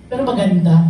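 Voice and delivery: real laughter breaking through, quick after; laughing. Real, not acted. Testifier laughing while telling church about the older churchmate. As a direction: chuckle through the punchline and rush on.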